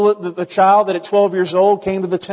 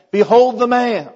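raised, ringing proclamation to the crowd; higher and louder, Pilate announcing the beaten man; Pilate proclaiming; 5 semitones higher, 4 dB louder than usual. Retold or imitated. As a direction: present the prisoner to the crowd. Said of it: "And Pilate says".

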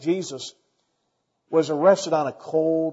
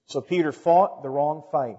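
normal talking voice

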